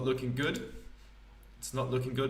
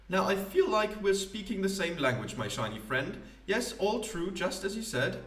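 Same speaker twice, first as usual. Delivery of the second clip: higher, grand theatrical poet voice; pompous poet; 7 semitones higher than usual. Acted. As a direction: play a self-important wandering poet. Poet warms to the robot.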